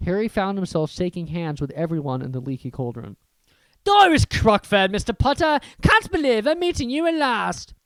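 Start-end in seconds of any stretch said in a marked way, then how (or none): 3.8-7.7 put-on high, eager old witch. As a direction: gush over meeting him. Narrator voices Doris Crockford.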